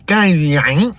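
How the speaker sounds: high, loud garbled Bostonian yelp; Boston caricature. Acted. Bostonian baffling someone not from Boston, garbled yelp. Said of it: "And then they say".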